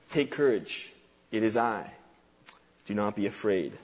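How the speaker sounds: quiet reassurance. Retold or imitated. Preacher voicing Jesus to frightened disciples, quietly reassuring. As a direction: hush it down to calm them.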